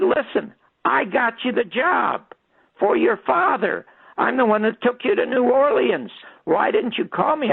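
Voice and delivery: high, aggrieved mock yell; retold screaming. Storyteller confronts the woman he got hired, high retold scream. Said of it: "I started screaming at her".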